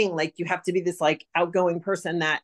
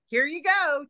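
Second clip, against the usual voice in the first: cheerful offer; 10 semitones higher than usual. Retold or imitated. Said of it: "I was like".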